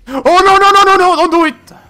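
high, loud panicked shout; panicked yell. Real, not acted. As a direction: beg in panic. Panicked yelling at the killer.

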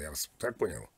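normal talking voice

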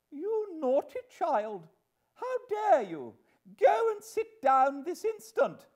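shrieked, high and loud reprimand; indignant scolding. Acted. Mother ordering little Jane to sit down, indignant scolding. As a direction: scold the child at full, high-pitched volume. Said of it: "not approving of this, said to Jane".

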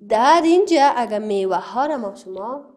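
ordinary delivery

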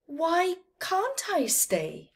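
high, slow, plaintive little calf; puzzled calf. A narrator's reading. Calf asks the camels why it must go, high and puzzled. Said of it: "asked the puzzled calf".

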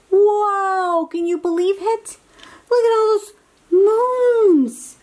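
high, loud wonder at all those moons; amazed. Acted. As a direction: gasp it out like a kid seeing the moons.

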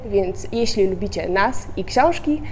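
ordinary talk

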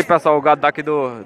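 ordinary delivery

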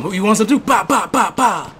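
loud, high taunting with gun noises; mock taunt. Gamer taunting a dead player, loud and high. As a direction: taunt the target, then spit out mock gunfire. Taunting objects while shooting.

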